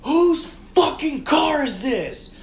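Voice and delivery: high, slow furious demand; mock scream. Angry driver demands the car's owner, high retold yelling. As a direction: stretch each word like yelling across a lot. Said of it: "Like, I'm screaming it".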